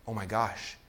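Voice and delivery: hushed, low retold amazement; quietly stunned. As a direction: say it softly, as if stunned. Retold stunned reaction to sales.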